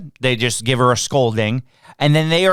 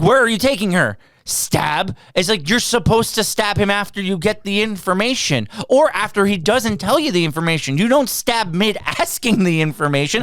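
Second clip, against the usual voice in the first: worked-up, higher-pitched rant; real indignation, pitched up high; heated; 6 semitones higher than usual. Real, not acted. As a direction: argue it with rising exasperation. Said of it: "I'm getting heated".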